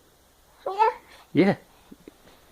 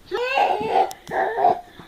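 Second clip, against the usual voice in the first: gasp. Real, not acted. Toddler finally walks.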